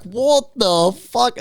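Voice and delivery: high, very slow drawn-out what the fuck; retelling the chicken surprise, stunned; slow stunned disbelief. Retold or imitated. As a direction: stretch it out in shock. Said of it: "And I was like".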